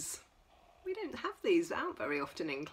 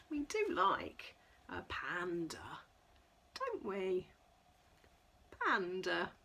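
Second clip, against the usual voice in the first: ordinary delivery, nothing marked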